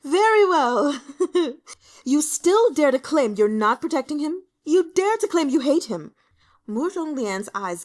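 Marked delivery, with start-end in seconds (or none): 0.0-1.0 loud, high, bitter shout through laughter; a cornered prince lashing out; furious laughing shout. Acted. 1.1-1.7 short, harsh, high laugh; a scornful bark of a laugh; bitter laugh. Acted. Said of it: "barking with laughter". 2.0-6.1 loud, high, mocking shout; furious taunt. Prince laughing through rage.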